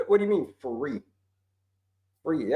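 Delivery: ordinary delivery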